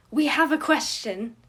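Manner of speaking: put-on loud, high, eager child blurting it out; excited kid voice. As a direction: burst in like a wild little boy. Excited kid Remus bursts in.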